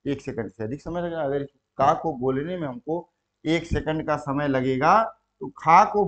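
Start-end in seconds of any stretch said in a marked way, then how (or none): none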